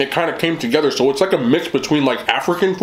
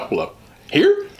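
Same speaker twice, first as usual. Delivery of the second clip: surprised; 10 semitones higher, slower than usual. Retold or imitated. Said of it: "I'm like".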